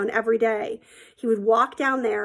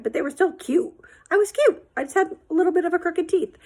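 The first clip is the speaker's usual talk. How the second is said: playfully defiant; 7 semitones higher than usual. Real, not acted. Defending her younger self.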